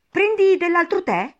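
very high, loud offer; serious March Hare. A narrator's reading. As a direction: offer it as the hare, pitched right up. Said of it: "disse seria". (voce acuta della lepre)

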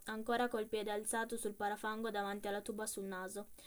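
ordinary delivery

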